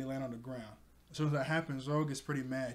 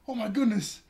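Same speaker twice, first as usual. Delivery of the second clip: gasp, then higher acted panic; freaking out; 7 semitones higher, 6 dB louder than usual. He sees Kuro's reflection in the glasses, freaking out. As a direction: play a startled freak-out. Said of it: "he starts freaking out".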